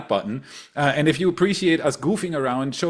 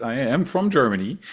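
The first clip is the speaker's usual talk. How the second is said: normal talking voice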